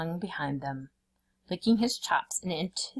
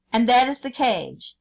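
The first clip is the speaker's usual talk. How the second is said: forceful tiger; 6 semitones higher, 7 dB louder than usual. A narrator's reading.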